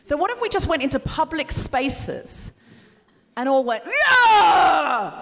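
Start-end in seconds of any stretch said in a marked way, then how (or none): 3.8-5.2 loud mock-hysterical scream; hysterical yell. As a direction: burst into a long wordless yell. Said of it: "We got hysterical".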